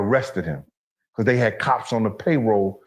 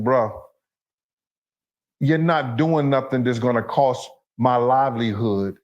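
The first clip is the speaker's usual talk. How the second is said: normal talking voice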